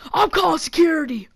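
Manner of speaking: high-pitched indignant announcement; angry threat. Retold or imitated. Scare actor threatening the kids, angry call. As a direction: call it out, acting an angry worker.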